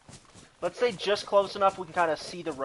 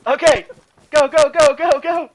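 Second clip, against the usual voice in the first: shouting; 6 semitones higher, 10 dB louder than usual. Real, not acted. Urging team to run.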